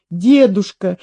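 ordinary delivery, nothing marked

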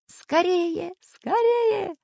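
very high-pitched urging; urgent high voice. A narrator's reading. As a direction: hurry them along in a high child-like voice. Fleeing friends hurry each other.

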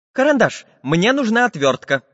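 lightly voiced high character; little iron man. A narrator's reading. Iron man asks the artist for a screwdriver, lightly voiced. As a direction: ask with a slight lift. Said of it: "попросил железный человечек".

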